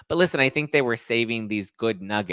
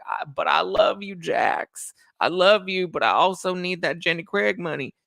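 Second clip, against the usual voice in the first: mock pleading Brittany; 9 semitones higher than usual. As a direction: whine it high like a desperate wife begging her husband. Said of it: "And she's just like".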